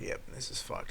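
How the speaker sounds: soft unvoiced aside; whispered resignation. Driver after being hit, whispered resignation. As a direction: mutter it under your breath.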